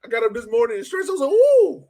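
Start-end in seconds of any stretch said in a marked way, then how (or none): none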